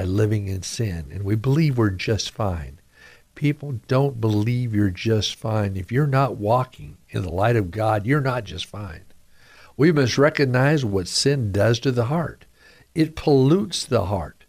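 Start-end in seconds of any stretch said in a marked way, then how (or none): none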